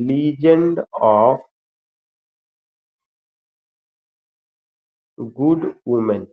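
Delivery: very slow, spaced-out dictation; word by word for note-takers, very slowly. Acted. Teacher dictates Chaucer's book title for students, slow dictation.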